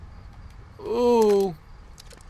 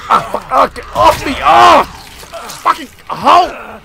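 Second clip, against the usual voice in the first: louder, higher yelling while grabbed; real panicked yell; 7 semitones higher, 11 dB louder, slower than usual. Real, not acted. Player yelling at a zombie grabbing him, panicked shout. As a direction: shout at the monster clawing at you. Attacked by enemy in game.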